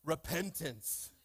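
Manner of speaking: Repentance stretched very slow; drawn-out repentance. Shown as a demonstration. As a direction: say Repentance slowly, leaning in. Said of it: "you gotta say it like that".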